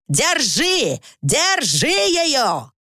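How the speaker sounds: high, stretched urgent bellow; frantic roaring order; yelled orders. A narrator's reading. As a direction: shout the command, then draw it out longer. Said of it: "заорал Уимис".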